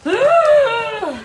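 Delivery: loud high exaggerated groan; frustrated groan. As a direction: let out a big dramatic groan. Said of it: "Use noises".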